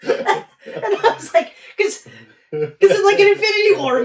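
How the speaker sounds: barely getting words out through laughter; laughing. Real, not acted.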